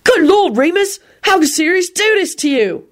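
high, aghast exclamation; appalled. A narrator's reading. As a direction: exclaim in shocked sympathy. Appalled William after shouting.

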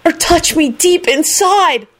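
high, pleading squeal; lovesick shriek. A narrator's reading. Lovesick Kenny keeps shrieking.

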